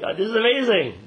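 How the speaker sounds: high, loud astonished gasp of wonder; stunned to hear the voice answer; shocked amazement. Retold or imitated. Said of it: "He's in shock".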